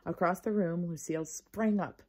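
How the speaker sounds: ordinary delivery, nothing marked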